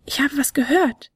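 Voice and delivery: a tiny flute hearing something; little flute Fionella. Acted. Fionella's small high voice.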